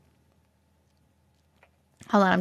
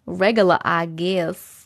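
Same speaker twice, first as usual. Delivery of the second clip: New York accent; 5 semitones lower, slower than usual. Shown as a demonstration. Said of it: "My, my New York accent".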